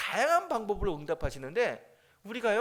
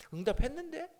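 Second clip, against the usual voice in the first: much higher, rising questioning reply; Jesus answering matter-of-factly; 9 semitones higher than usual. Acted. Jesus replying to the angry churchgoer, acted questioning tone. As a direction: answer as if genuinely puzzled by the complaint. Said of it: "예수님이 이렇게 이야기해요".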